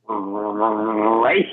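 low, drawn-out mock reluctance; acted-out reluctant agreement; grudging concession. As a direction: drag it out low, creeping up into a question. Retold grudging concession to wife.

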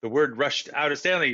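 usual speaking voice

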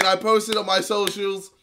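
normal talking voice

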